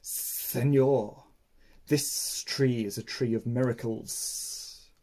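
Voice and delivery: coil the words slowly like a snake; snake hiss. Acted. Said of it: "hissed in his ear".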